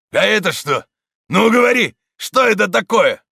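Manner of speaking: high, loud pressing demand; demanding interrogation. A narrator's reading. Stonecutter interrogating the priest, harsh demand. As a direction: shove it under his nose and demand.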